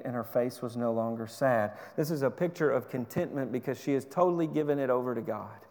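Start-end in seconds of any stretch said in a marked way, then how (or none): none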